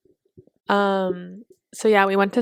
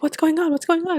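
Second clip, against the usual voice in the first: confused bystanders; 9 semitones higher, faster than usual. Retold or imitated.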